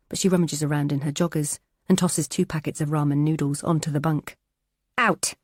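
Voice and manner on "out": a higher, barked one-word order; sharp order. Acted. Teresa ordering Kelk out of the cell. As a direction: throw her out with one word.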